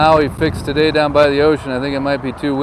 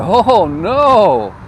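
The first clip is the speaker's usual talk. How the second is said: laughing mock dismay; 5 semitones higher, 7 dB louder than usual. Mock outrage at closed restaurants.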